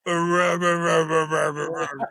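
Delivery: loud growled rah rah, like a beast answering; caveman wolf grunts. Retold or imitated. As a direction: bark it out like a half-wolf caveman. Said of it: "and he goes".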